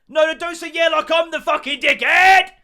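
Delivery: very high and loud furious squawk; outraged shout. Retold or imitated. To Bill who said yeah, a high furious shout. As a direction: blow up at him.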